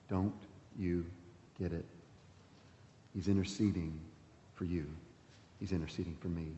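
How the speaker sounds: almost hush it to the congregation; gentle, low-voiced pleading; quiet appeal. Preacher's intimate closing appeal.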